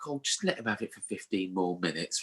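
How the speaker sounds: soft, low imitation of a mum; hushed put-on mum voice; pleading mum. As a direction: quietly plead on the lad's behalf.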